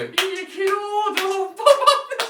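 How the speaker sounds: high, slow, very loud celebratory yell; shouted birthday greeting. Retold or imitated. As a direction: belt out the birthday wish, dragging it out. Acted birthday surprise shouted at grandmother. (bağıra bağıra doğum günü kutlaması)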